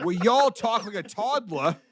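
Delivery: high mock New York accent, stretching "y'all". Correcting a friend's impression, exaggerated New York accent. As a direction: put on a New Yorker, pitched well up. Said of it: "y'all say things differently".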